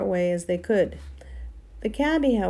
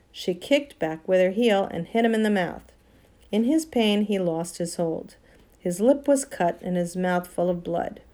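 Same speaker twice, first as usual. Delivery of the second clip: ordinary delivery, nothing marked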